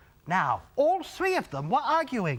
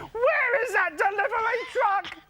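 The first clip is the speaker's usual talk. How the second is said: angry grocer yelling; 10 semitones higher than usual. A narrator's reading. Grocery man yelling on the sidewalk for his truck. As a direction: demand the truck, fuming. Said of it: "stomped out onto the sidewalk and yelled".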